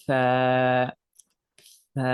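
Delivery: সা with the tongue tip just out between the teeth; Arabic tha demo. Shown as a demonstration. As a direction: let the tongue peek out for সা. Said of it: "আমার সাথে সাথে উচ্চারণ করেন".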